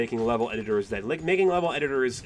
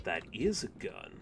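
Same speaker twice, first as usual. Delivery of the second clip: normal talking voice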